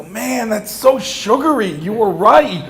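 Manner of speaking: high, slow mock amazement; hammed-up shock at the sweetness; mock surprise. Tasting the sugared tea in front of her family, hammed-up surprise.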